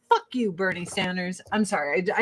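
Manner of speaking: usual speaking voice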